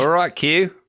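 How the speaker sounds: mock Australian, high and loud; hammed-up Australian call to Q. Joining Australian bit.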